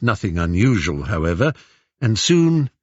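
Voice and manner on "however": plain reading voice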